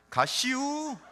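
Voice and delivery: drawn-out, high-pitched Chungcheong dialect; Chungcheong accent. Retold or imitated. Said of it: "충청도 분들은 어떻게 해요?".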